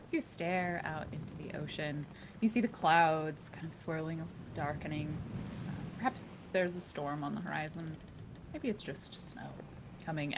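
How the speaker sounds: soft, low scene setting; game master narration. Acted. Game master describing the sea to a player, hushed narration. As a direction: paint the scene quietly.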